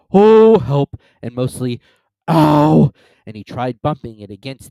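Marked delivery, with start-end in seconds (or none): 0.1-1.0 loud, raised cry from a stuck bear; Pooh. A narrator's reading. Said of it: "he said inside the jar". 2.3-2.9 loud acted "ow" from a stuck bear; loud yelp of pain. A narrator's reading. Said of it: "he said inside the jar".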